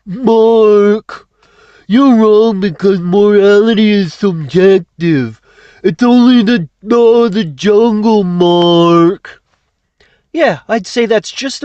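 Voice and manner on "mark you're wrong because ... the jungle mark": nail the mocking voice; put-on voice. Speaker mimics an opponent scolding Mark in a loud put-on voice. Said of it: "get the voice just right".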